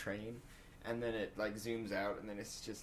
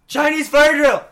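high loud sudden shout; 20 dB louder, slower than usual. Real, not acted. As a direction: yell it out to the room. Calling out a seat swap.

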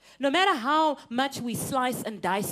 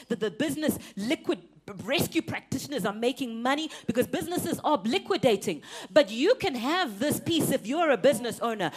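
usual speaking voice

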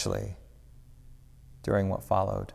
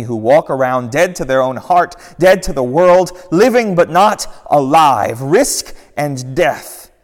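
Jesus, impassioned; 5 semitones higher, 8 dB louder than usual. Acted. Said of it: "it says again".

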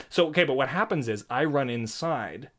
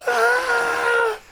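much higher, loud panicked yelp; panicked cry; 6 dB louder than usual. Retold or imitated. Dog nearly hit by a car, high panicked yelp. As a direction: let out a desperate high cry. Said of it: "And I'm just like".

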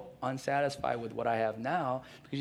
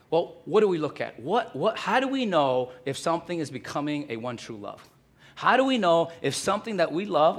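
preaching at full force; 4 semitones higher, 7 dB louder than usual. Acted. Preaching raises intensity.

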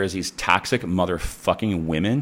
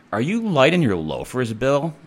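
higher, louder sly jab at a coworker; mocking tease; 8 semitones higher, 4 dB louder than usual. Charge nurse taunts Bill at the counter, high, louder mocking tease. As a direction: needle him with a sneering question.

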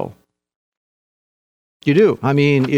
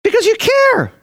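emphatic high cry. Real, not acted. Preacher's emphatic peak.

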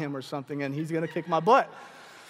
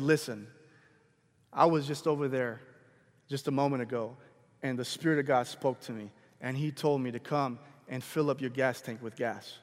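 low, calm and measured, with pauses; quietly bold; 6 semitones lower, 4 dB quieter than usual. Retold or imitated. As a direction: deliver a message softly but with conviction. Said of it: "I just felt boldness come on me".

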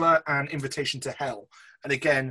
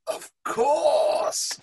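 high, exaggerated Of course; hammed-up eager agreement; mock enthusiastic; 13 semitones higher, 4 dB louder than usual. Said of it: "And you kind of go".

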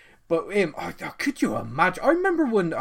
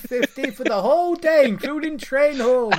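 mock old-timer, pitched up and louder; fifties old-timer reminiscing; 4 semitones higher, 5 dB louder than usual. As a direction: put on a voice for reminiscing about bygone prices. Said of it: "I sound like I'm talking about the fifties".

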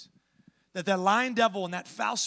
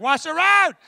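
a big, high shout of alarm; shouted warning; 10 semitones higher, 14 dB louder than usual. Retold or imitated. Passenger yelling at the speeding driver to watch the road, panicked shout. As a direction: yell it out in panic.

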